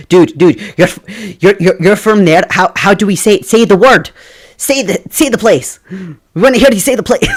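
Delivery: hammed-up fans begging, ending in a laugh; mock eager begging. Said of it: "because everyone's like".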